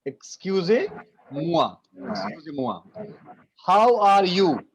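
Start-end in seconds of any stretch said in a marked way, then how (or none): 0.0-1.8 slow, careful excusez-moi spoken syllable by syllable; slow model word. Shown as a demonstration. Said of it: "Excuse me is excusez-moi". 2.1-2.8 soft repeat. Shown as a demonstration. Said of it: "Excuse me is excusez-moi".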